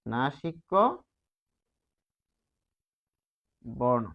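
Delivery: নাসিক্য, long pause, then বর্ণ; slow dictation. Shown as a demonstration. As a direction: say নাসিক্য বর্ণ word by word as if writing it. Teacher dictating the term while writing.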